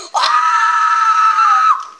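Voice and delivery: very high, very loud scream; panicked scream. Real, not acted. Player facing an elite with a sword, screaming. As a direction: scream at the top of your voice.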